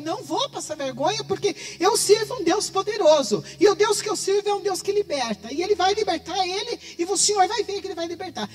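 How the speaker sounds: bold declaration. Retold or imitated. Defying the father in faith.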